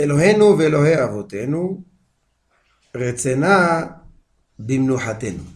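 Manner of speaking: loud, drawn-out prayer reading; Hebrew prayer recitation. Acted. Rabbi reading the prayer to students, measured loud recitation. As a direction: recite it phrase by phrase, with long pauses.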